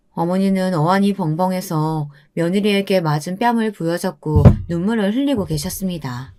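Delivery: plain reading voice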